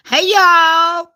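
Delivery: high, put-on American holler; American accent. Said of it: "when I'm in America, I'm like".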